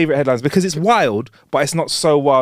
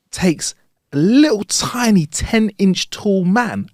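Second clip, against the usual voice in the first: drawn out; 7 semitones higher, much slower than usual. Acted. Slow emphatic joke detail.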